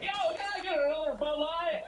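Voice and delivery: hammed-up Southern beer order; southern accent. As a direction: go Southern, loud and laughing. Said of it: "he, like, turns southern".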